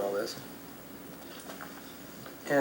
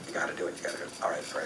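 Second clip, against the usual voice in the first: low, soft muttered self pep talk; talking himself into it under his breath; quietly psyching himself up; 6 semitones lower, 9 dB quieter, much faster than usual. Retold or imitated. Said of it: "finally got the guts".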